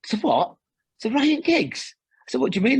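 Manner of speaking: mock-baffled, pitch jumping up; retold disbelief. Acted reaction to being told he's Giggs.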